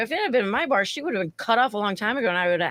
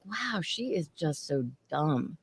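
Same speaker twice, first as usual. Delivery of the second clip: low, slow, softer thought; quiet aside; 3 semitones lower, 8 dB quieter, slower than usual. Retold or imitated. Server thinking about the drunk customer, low and slow. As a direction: murmur it to yourself. Inner thought, laughing at her.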